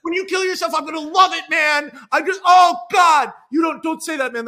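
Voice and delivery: loud, high, half-laughing shout; mock gleeful yell. [name] yelling at David about his suicide, loud mock glee. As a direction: holler it at your friend.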